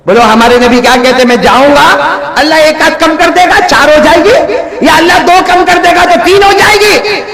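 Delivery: preaching at full force. Acted.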